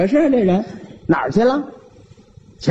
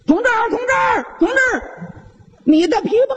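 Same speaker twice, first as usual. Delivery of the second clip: calling out; 14 semitones higher, much faster than usual. Acted. The veteran on the bike calls ahead to the accountant about his wallet, shouting. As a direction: yell ahead to get the man's attention. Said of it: "复员军人那儿直喊".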